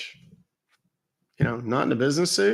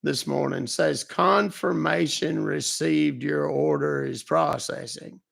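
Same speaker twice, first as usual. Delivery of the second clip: put-on old man Lou; 4 semitones higher than usual. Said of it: "He was questioning my voice".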